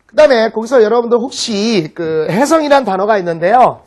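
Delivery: ordinary talk, nothing marked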